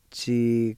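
Chi-gu-si said carefully in Cherokee, chee-goo-see; careful teacher model of Chi-gu-si; Cherokee pronunciation. Shown as a demonstration. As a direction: pronounce Chi-gu-si as a Cherokee word for learners. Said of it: "you can pronounce that Q with the T S I like this".

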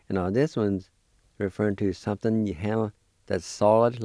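ordinary talk

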